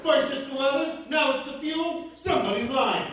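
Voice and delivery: high, loud suspicious lady voice; a loud, high, suspicious passenger; sassy lady. Retold or imitated. Passenger voicing distrust of the crew, loud and high.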